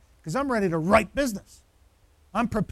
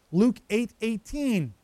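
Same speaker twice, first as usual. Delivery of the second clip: slow dictation; slower than usual. Shown as a demonstration. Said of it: "I'll give those to you again".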